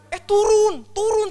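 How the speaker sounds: very high, loud shout; calling down. Retold or imitated. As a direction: yell up to helpers hiding upstairs. Calling hiding helpers to come down.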